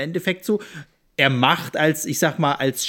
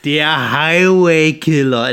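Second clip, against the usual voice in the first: air quotes; 5 dB louder, much slower than usual. Acted. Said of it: "in Anführungszeichen".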